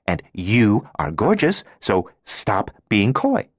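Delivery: put-on a bold, teasing, know-it-all girl laying it on thick; brash Thelma. Thelma's character voice.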